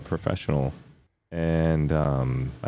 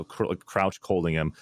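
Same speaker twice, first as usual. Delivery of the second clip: usual speaking voice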